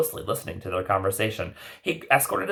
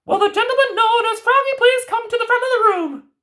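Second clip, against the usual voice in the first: much higher, louder kindly grandmother; high fairy-tale granny voice; 7 dB louder than usual. Acted. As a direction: play the knowing old grandmother. Grandmother character voice.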